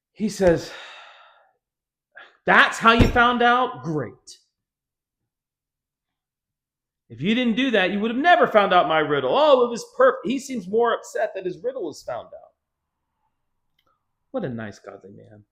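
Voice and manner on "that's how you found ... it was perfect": loud, peeved complaint; mock-indignant husband; indignant Samson. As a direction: sound put out and loud. Samson upset about riddle.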